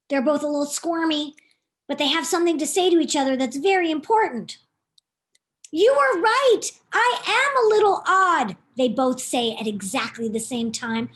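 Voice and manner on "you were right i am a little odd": higher, louder duck voices; earnest confession. Acted. Theodora and Chad admitting fault to each other, higher. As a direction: say it shyly but brightly. Ducks reconcile.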